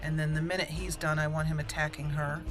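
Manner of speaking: ordinary talk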